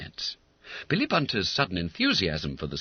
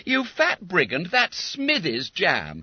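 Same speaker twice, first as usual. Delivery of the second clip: accusing schoolboy; 11 semitones higher, 3 dB louder, slower than usual. A narrator's reading. Bob accusing.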